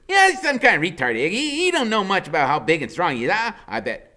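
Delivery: high, loud chatter; high giddy woman. A narrator's reading. Loretta guessing to Iggy, high and loud. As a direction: gossip eagerly.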